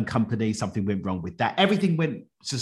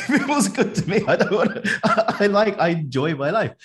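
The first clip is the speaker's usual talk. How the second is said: higher and louder, laughing through the words; laughing plea; 11 semitones higher, 8 dB louder than usual. Retold or imitated. Guest retells bargaining with God, laughing. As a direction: giggle as you bargain, half-joking.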